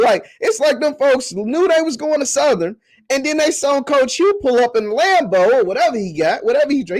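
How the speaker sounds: rile up the Southern fans with high, gleeful jabs; excited teasing. Real, not acted. Teasing rival fans over lost recruits.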